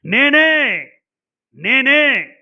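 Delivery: proclaiming it loudly as God's own voice; loud emphatic. Shown as a demonstration. Preacher booming God's words at the congregation, loud and emphatic. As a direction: boom out each word like a declaration. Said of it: "ఎందుకు ఇంత గట్టిగా మాట్లాడాను తెలుసా".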